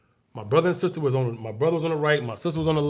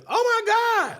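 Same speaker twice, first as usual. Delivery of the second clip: very high shocked oh my God; shocked gasp; slower than usual. Retold or imitated. Nurse seeing the crash victims, high shocked cry. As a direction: squeal it out like a horrified nurse.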